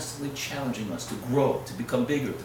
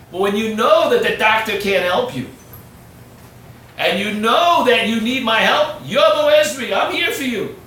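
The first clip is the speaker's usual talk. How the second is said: louder, higher passionate preaching; 7 semitones higher, 9 dB louder than usual. Acted. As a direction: build to a passionate plea. Preaching climax.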